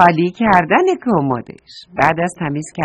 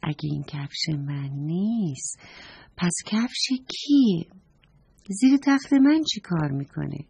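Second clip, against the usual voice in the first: softly wondering, rising on the questions; musing to oneself; talking to himself; 5 dB quieter than usual. A narrator's reading. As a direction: puzzle it out under your breath. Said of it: "با خودش گفت".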